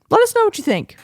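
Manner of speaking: squeaky high sign-off; squeaky cutesy voice. Pretend. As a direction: chirp it out in a cute voice.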